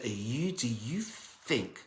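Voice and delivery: slow, soft scornful sneer; mock them slowly, cold and quiet; sneering miser. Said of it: "And then he laughed".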